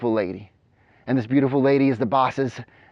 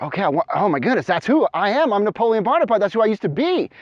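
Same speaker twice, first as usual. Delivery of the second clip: higher, faster amazed outburst; excited realization; 7 semitones higher than usual. Acted. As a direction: gasp it out like a revelation. Discovering he was Napoleon.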